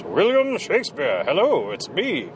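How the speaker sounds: big booming hammy stage actor; master thespian voice. Retold or imitated. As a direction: ham it up like a grand thespian. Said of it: "this big, you know, master thespian voice".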